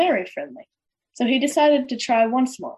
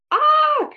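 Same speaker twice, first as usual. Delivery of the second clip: very high, loud witch scream; piercing storybook witch screech; witch's shriek; 5 dB louder than usual. A narrator's reading. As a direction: let out a sudden high shriek. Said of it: "shrieked the witch".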